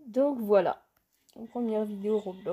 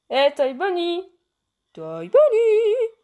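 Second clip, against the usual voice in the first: loud, high, drawn-out call; excited hailing; 8 semitones higher, 8 dB louder, slower than usual. Real, not acted. Player hailing Toy Bonnie in game, excited call. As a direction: sing out the name, louder the second time.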